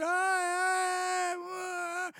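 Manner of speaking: hoge nagespeelde jammerkreten; mock wailing. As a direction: imitate the wailing passengers, high. Imitating wailing passengers.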